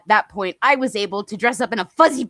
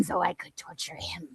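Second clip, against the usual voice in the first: whisper; 5 semitones lower, 11 dB quieter than usual. Real, not acted. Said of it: "whispered aside".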